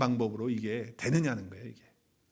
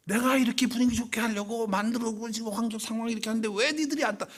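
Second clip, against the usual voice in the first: a hint of the drunk father, raised pitch; 7 semitones higher than usual. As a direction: complain like the tipsy dad who set up the party. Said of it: "그 아버지 술 먹고 와가지고". (술 먹고 온 아버지를 살짝 흉내 내며 높게 따지듯)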